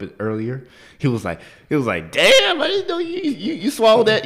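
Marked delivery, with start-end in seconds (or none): 2.1-4.2 very high, loud astonished exclamation; mock amazement. Waiter amazed at how she swallowed the soup, exclaiming. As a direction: pitch it way up in hammed-up disbelief.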